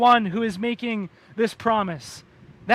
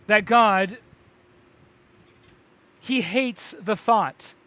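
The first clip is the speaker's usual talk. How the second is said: ordinary delivery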